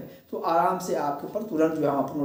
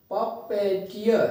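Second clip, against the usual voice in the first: Puppeteer slowly split into syllables; much slower than usual. Shown as a demonstration. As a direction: break puppeteer into its separate parts. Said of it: "अलग-अलग syllables को". (Puppeteer को टुकड़ों में, धीरे)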